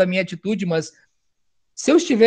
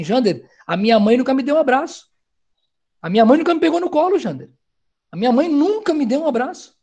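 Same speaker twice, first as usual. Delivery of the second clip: voicing a hurt young person, higher and pleading; hurt youth; 4 semitones higher than usual. Young person telling the speaker, in a lightly voiced hurt plea. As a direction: plead like a child who never got a hug. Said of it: "Eles falam assim".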